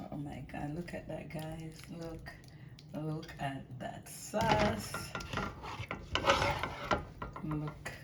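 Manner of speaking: soft, slow, hushed marvelling; hushed delight over the sauce; hushed awe. Real, not acted.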